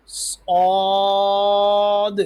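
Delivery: صاد full-mouthed, long high vowel, released d at the end; heavy saad. Shown as a demonstration. Teacher models for pupils, heavy long صاد. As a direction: hold صاد loud and thick, sound the final d. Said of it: "د آخر میں آواز نکلے اور موٹا ہوگا".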